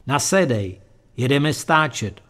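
plain reading voice

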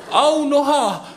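very high, loud storybook howl; pained yell. A narrator's reading. Bird Noha is bitten by a snake, pained yell. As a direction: yelp it high and held, acted pain. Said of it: "zařval bolestí pták Noach".

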